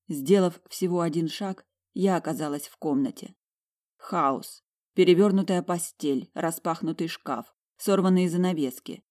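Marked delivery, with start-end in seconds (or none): none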